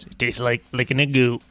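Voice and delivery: light Southern accent, clownish. Shown as a demonstration. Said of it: "my generic Southern clown voice".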